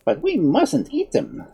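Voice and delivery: slow, high-pitched warning. A narrator's reading. Wizard warning the children off the fruit, cautionary. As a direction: caution them, drawing the words out. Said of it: "the wizard warned the children".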